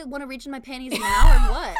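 loud mocking dare through a laugh; laughing loud taunt. Mocking a woman who changed her diapers, loud laughing dare. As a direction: fire it back laughing. Crude joke comeback.

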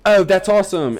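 hammed-up British reality-show squeal of excitement; British accent. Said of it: "that was an amazing, um, accent".

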